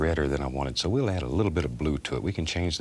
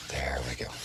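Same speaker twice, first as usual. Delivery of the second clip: whispering; 2 semitones lower, 3 dB quieter than usual. Real, not acted. Soft aside.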